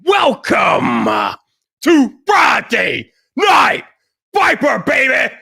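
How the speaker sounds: shouted, high hype-man opener, drawn out and roaring; hype show intro. Acted. Host opening his Friday night live stream, shouting hype-man style. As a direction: pump up the crowd like an arena announcer.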